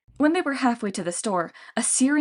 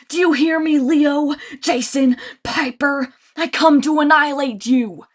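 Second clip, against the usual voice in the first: loud monster threat rising to a high shout; growling Cyclops; 4 semitones higher, 6 dB louder, slower than usual. A narrator's reading. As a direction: vow revenge to the demigods. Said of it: "The Lady Cyclops growled".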